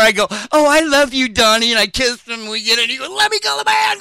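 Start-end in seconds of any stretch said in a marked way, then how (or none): 0.5-1.7 mock gushing. Mock greeting to Donnie. 3.1-4.0 pained yelp. Retold or imitated. Donnie yelling at friend to let go, high pained shout.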